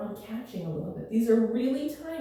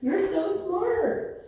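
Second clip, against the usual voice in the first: talking to a dog; 7 semitones higher, much slower than usual. Real, not acted. Praising dog, high slow.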